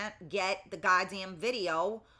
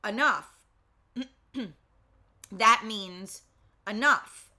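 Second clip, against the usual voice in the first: enough said much louder and firmly, as a final warning; 7 dB louder, slower than usual. Shown as a demonstration. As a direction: land enough like a hard stop. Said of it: "So when I'm telling you enough".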